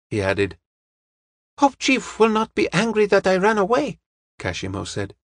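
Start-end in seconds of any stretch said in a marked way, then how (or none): 1.6-4.0 high-pitched, anxious underling voice; worried assistant. Acted. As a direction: ask it high, hoping to be forgiven. Worried assistant asks the detective.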